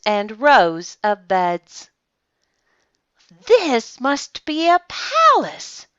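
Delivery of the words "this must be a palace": high, slow, awed gasp of a little bear; gasped in wonder. A narrator's reading. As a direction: breathe it out in amazement. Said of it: "Corduroy gasped".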